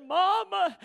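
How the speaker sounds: high, lightly voiced daughter calling Mama; a light imitation of a crying girl on the phone. Pastor imitating his daughter's call, lightly voiced.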